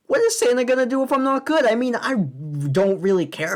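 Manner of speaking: high-pitched indignant protest; mock outrage. Ranter objecting to Santa's threat in the lyrics, mock outrage. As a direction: blurt it out in exaggerated, high indignation.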